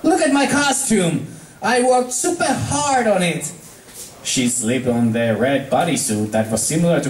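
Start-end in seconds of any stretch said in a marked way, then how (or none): 0.0-3.4 high, proud tomboy voice; Rainbow, showing off; proud Rainbow Dash. Acted. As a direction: show it off. Rainbow shows costume.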